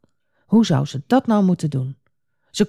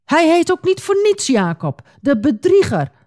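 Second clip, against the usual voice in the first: high, indignant outburst; storybook rage, voice raised in pitch; angry cry; 10 semitones higher than usual. A narrator's reading. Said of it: "schreeuwde Esau".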